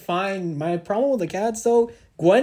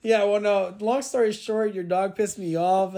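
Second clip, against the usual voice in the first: ordinary talk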